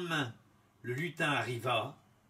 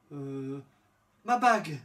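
put-on girl's voice; 6 dB louder than usual. A narrator's reading. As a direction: answer the imp brightly. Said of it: "répondit-elle".

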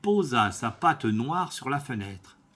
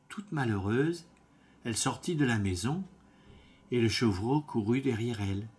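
ordinary delivery

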